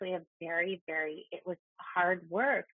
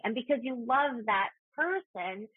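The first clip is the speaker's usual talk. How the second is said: ordinary delivery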